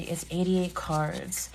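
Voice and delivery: ordinary talk, nothing marked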